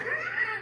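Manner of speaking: sudden high-pitched laugh; a short, high, quiet cackle; high burst of laughter. Real, not acted. Laughs at people on fentanyl.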